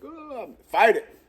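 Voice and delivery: put-on high squeaky little kid; baby voice. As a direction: mimic a whiny toddler. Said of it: "It's like I tell the babies".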